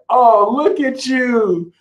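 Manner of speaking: high, slow, mock-admiring coo; sweetly patronize them, drawn out; sarcastic cooing. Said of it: "Be like".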